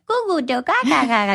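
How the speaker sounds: put-on high babbling baby talk; baby babble. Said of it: "with Charlotte, she's like".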